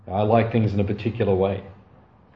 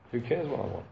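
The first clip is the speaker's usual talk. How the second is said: much softer, said inward to himself; soft reflective repeat; 10 dB quieter than usual. Real, not acted. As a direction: let it sink in quietly. Speaker repeats lesson to himself.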